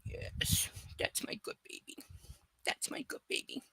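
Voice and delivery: soft whispered cooing; whispered praise to dog. Real, not acted. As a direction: whisper sweetly to the puppy.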